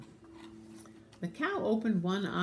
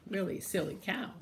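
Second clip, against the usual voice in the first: usual speaking voice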